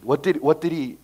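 ordinary talk, nothing marked

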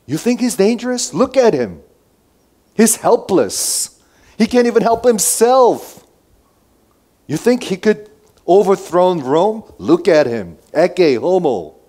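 loud, high-pitched scoffing appeal; incredulous challenge. Acted. Pilate showing helpless Jesus to the crowd, loud and incredulous. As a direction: throw it at the crowd, loud and insistent.

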